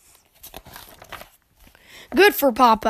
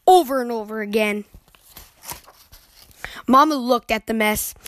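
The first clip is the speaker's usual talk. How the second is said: ordinary delivery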